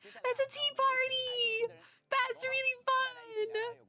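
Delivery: very high, slow squealing delight. Real, not acted. Gamer squealing at a cute tea party, very high and slow. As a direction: squeal it out, drawn long and high.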